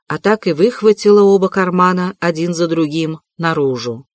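usual speaking voice

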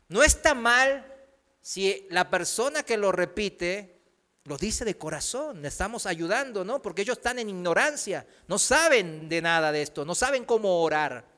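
ordinary talk

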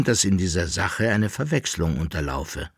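usual speaking voice

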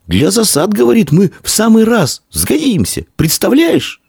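put-on very high, thrilled boy's voice; high-pitched excited cry; breathless shouting. A narrator's reading. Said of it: "задыхаясь, кричал он".

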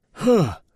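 sharp high gasp as the air is knocked out; a short high burst of breath; winded gasp. A narrator's reading. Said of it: "вышибло воздух из лёгких".

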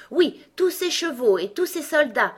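high, loud, proud confirmation; boastful egg. A narrator's reading. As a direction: crow about the promised army. Egg character resumes boasting. (gros coco triomphant)

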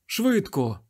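high urgent cry; frantic high plea. A narrator's reading. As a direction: hurry them, pitched high. Desperate plea for help.

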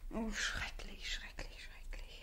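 slow breathy horror, fading; three dying whispers of horror; despairing whisper. Acted. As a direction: whisper it as it sinks in.

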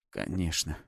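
soft, low Конечно; quiet. Acted. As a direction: breathe Конечно softly. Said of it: "тихо произнёс я".

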